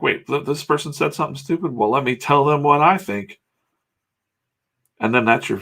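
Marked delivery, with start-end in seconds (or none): 0.0-3.4 mock indignation. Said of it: "You're like".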